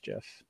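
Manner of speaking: "gif" said like jiff; soft g. Shown as a demonstration. Citing the inventor, soft-g gif. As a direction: begin "gif" with a j sound. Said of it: "should be pronounced gif".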